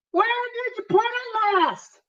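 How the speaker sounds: wife's voice. Acted. Wife voiced in alien skit.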